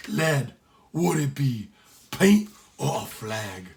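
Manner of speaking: slow Irish brogue, wondering; curious Irish sailor; Irish accent. Acted. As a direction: ask the lad in a thick Irish lilt. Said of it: "Sean's accent was so heavy".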